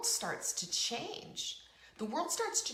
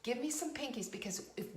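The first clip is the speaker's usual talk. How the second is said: ordinary delivery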